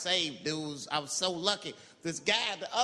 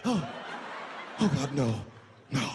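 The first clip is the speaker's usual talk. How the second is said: mock horrified plea; 4 semitones lower, slower than usual. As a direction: beg in disgust. Victim horrified.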